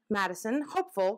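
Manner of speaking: plain reading voice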